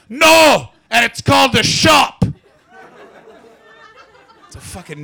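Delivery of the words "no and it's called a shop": high, loud Scottish correction; mock drunk hollering; drunk shout. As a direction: bellow it like a drunk putting him right. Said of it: "And he went".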